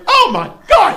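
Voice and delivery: high, loud shocked gasp of a prim woman; scandalized uptight lady shriek; shocked rich lady. Retold or imitated. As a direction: clutch your pearls and squeal it.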